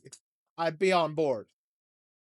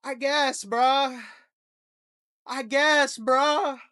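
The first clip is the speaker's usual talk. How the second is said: higher exasperated bruh, shouted at the end; resigned mock citizen; 7 semitones higher, 3 dB louder, much slower than usual. Fed-up citizen answering the government, higher and building to a shout. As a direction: throw up your hands and yell the last bruh. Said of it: "And it's like".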